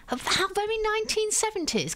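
high, arch "how very" sniff; mock disdain. Real, not acted. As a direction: sound archly unimpressed. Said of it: "mock-posh disapproving remark".

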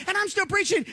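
ordinary talk, nothing marked

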